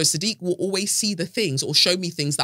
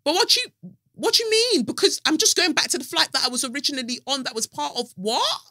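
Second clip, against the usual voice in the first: outraged disbelief; 9 semitones higher, faster than usual. Retold or imitated. Her outrage at the airline.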